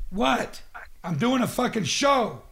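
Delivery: high-pitched exasperated retort; indignant at being interrupted; irritated. Acted. Radio host to interrupting producer, irritated.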